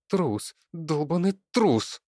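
high-pitched, seething contempt; angry hissing. A narrator's reading. Daughter seethes at Leonid, high and venomous. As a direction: spit the insult out, slowing on the second half. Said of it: "шипела Алёна".

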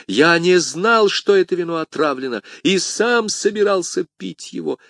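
high, urgent plea of innocence; high-pitched earnest protest; desperate protest. Acted. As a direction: plead to be believed. Protesting innocence to dying man.

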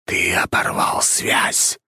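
slow, whispered spirit rebuke; ghostly drawn-out whisper; otherworldly whisper. A narrator's reading. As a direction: whisper it slowly like a voice in the head. Familiar accuses the hero.

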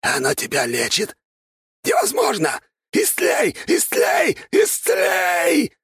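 high eerie squealing screech; otherworldly shriek. A narrator's reading. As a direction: shriek it like an undead thing. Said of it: "Потусторонним голоском заверещал он".

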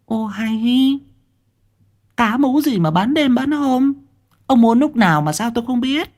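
high shrill-free wife voice, questioning; bà vợ hỏi dồn, giọng cao; wife's voice. Acted. (giọng bà vợ ngạc nhiên, cao)

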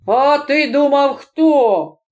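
ordinary delivery, nothing marked

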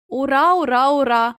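understated hurrah, slightly lifted; a touch of jubilation; gleeful cheering. A narrator's reading. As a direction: cheer lightly, as if dancing along. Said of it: "пританцьовував Тім".

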